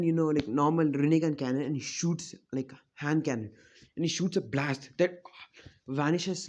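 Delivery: usual speaking voice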